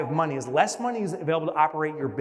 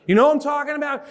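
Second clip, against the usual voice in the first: ordinary talk, nothing marked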